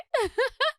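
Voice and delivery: a short cheeky laugh up high; playful high giggle. Acted. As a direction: giggle at your own joke. Daughter laughing at her joke.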